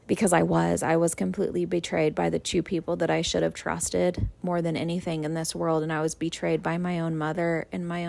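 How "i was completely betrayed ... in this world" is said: usual speaking voice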